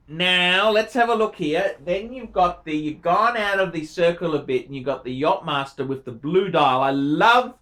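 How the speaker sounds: usual speaking voice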